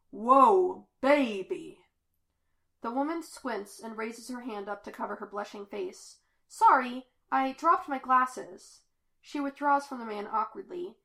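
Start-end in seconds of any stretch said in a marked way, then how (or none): 0.1-1.8 loud, impressed come-on; smitten ladies' man. A narrator's reading. Smitten man reacts to a woman. 6.5-8.8 higher, louder woman's apology; flustered apology. A narrator's reading. Flustered woman apologizes after bumping him.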